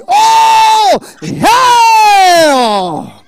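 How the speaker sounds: very high, long, climactic shout; huge shouted hail. Acted. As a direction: hold each word at full volume. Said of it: "You need to shout".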